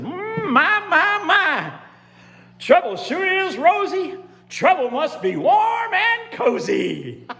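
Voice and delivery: high, loud, crowing character voice; delighted gator king. Acted. Gator king relishing the first glow of fire, high and gleeful. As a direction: crow it high, stretching the last words. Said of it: "He said".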